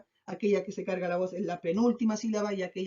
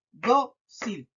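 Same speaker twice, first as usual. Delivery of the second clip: "Dócil" split into DÓ, then cil; syllable by syllable; 3 dB louder than usual. Shown as a demonstration. Teacher finding where the stress falls, syllable by syllable. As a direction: sound out "Dócil" in two slow beats. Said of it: "identificar dónde se carga la voz".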